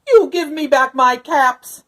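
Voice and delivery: loud, slow, angry demand; angry peddler. A narrator's reading. Peddler shouting at monkeys for his caps, angry. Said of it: "This made the peddler very angry".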